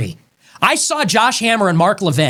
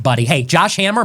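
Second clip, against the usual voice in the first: normal talking voice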